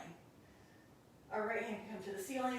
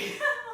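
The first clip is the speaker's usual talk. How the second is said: a short burst of winded laughter, higher and louder; breathless laugh; 12 dB louder than usual. Real, not acted. Yoga teacher laughing at being winded mid-class. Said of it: "a little out of breath".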